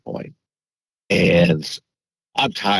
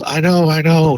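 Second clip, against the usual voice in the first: higher, louder imitation of her upset; upset insistence; 10 semitones higher, 5 dB louder than usual. Girlfriend answering his complaint, higher and louder. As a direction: plead it back, overwhelmed. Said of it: "And she goes".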